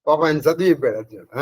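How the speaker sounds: usual speaking voice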